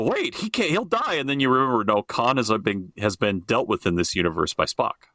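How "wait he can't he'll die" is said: mock fan panic. Fans realizing the character is doomed, in high mock alarm. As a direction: act out a fan suddenly panicking. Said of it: "and so we'll go".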